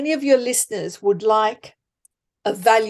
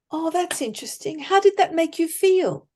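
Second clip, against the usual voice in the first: lightly raised, gently curious asking; curious question; 7 semitones higher than usual. Shown as a demonstration. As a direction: sound genuinely interested, not probing. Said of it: "Ask questions".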